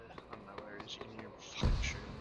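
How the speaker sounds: hushed urgent whisper on the move. Real, not acted. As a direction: whisper fast so nobody hears. Hiding during a firefight.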